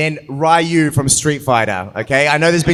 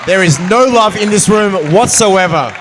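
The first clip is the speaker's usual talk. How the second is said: mock outraged shout; 4 semitones higher, 6 dB louder than usual. Real, not acted. Host teasing a quiet crowd.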